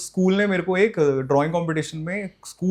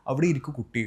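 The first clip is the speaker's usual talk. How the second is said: Palakkad Malayalam; 4 semitones lower, 7 dB quieter, much faster than usual. Shown as a demonstration.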